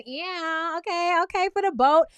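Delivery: high, hyped-up approving reaction; impressed approval. Acted. Host reacts to the boat date, impressed approval.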